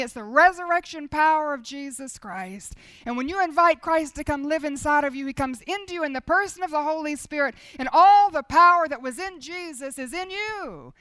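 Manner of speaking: preaching at full force. Acted. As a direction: drive it home to the people. Preaching the resurrection power.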